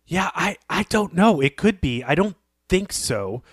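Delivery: ordinary talk, nothing marked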